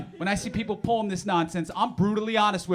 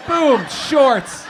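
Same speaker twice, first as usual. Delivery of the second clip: loud punchline; 5 semitones higher, 9 dB louder than usual. Acted. Punchline announcement.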